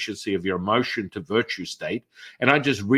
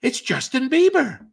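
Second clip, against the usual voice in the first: very high, dreamy answer; dazed friend; 5 dB louder than usual. Retold or imitated. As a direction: say it like someone half hypnotized. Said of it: "and they're like".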